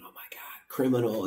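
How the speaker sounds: whispered gossip of staring passengers; imagined whisper. Retold or imitated. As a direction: hush it like nosy old folks.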